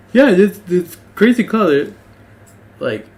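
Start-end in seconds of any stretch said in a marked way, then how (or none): 0.1-1.9 high, loud dog voice; a goofy awed dog. Acted. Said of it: "he would be like".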